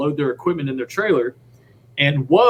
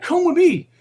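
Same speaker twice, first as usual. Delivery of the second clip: eager invite; 14 semitones higher than usual. Retold or imitated. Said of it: "He's like".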